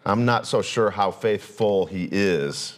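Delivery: "No, I saw him" said low and suspicious; skeptical churchgoer. Retold or imitated. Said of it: "you say".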